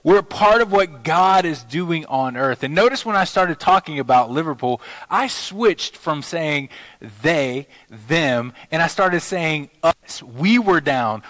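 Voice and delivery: usual speaking voice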